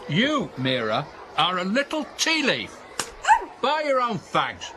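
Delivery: put-on slow, high scolding of a child; stern coach. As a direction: tell the kid off slowly.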